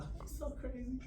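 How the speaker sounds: high, soft amused granny; laughing grandma voice. Retold or imitated. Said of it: "Grandma's still looking at me like".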